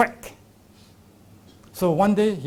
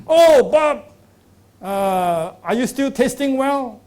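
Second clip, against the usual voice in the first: cheerful doctor greeting; 5 semitones higher, 5 dB louder, slower than usual. Retold or imitated. Doctor greets patient again.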